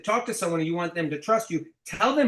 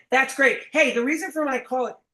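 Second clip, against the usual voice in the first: fake-cheery rep; 7 semitones higher, 4 dB louder than usual. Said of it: "you go".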